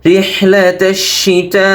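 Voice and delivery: slow chanted "রিহলাতাশিতা", long held ending; four-alif elongation. Shown as a demonstration. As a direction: stretch the last vowel of "রিহলাতাশিতা". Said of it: "এখানে চার আলিফ টান হবে".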